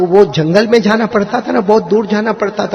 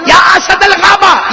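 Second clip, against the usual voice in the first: bold shout; 13 semitones higher, 6 dB louder, slower than usual. A narrator's reading. Companion boldly addresses the lion.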